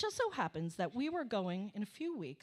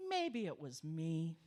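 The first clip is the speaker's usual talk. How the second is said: normal talking voice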